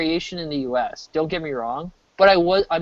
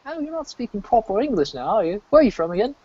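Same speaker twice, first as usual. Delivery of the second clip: mock British police; 5 semitones higher than usual. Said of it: "It's like".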